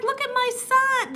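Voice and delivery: high, sing-out mock mom voice; imitating the lady. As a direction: put on a high-pitched proud mother. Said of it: "This is how she was talking".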